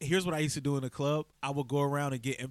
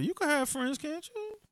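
high-pitched mock coaxing; wheedling pickup line; 11 semitones higher than usual. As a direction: wheedle it, rising high at the end. Mimics man hitting on woman.

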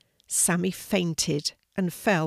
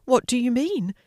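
higher, louder upset question; tearful; 6 semitones higher, 5 dB louder than usual. A narrator's reading. Sidney challenges the hedgehog, mildly tearful. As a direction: ask it hurt and upset. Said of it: "as he sniffed back his tears".

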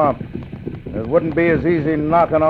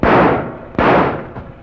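breathy villain chuckle. Acted. Bandit after threatening to kill.

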